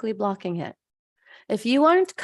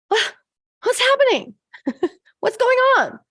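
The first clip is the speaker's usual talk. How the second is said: mock panic; 13 semitones higher, 7 dB louder than usual. Mock panic over the cake.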